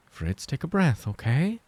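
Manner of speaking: high, gentle, coaxing; high soothing mother. Acted. Clara calms him.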